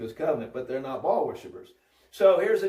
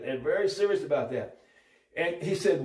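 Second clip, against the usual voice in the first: ordinary talk, nothing marked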